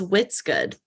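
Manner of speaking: WHAT'S with a short i, like "wits"; WHAT'S as "Whit's". Shown as a demonstration. Host playing on her slip, WHAT'S said as "Whit's". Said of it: "I just said, "What's good?".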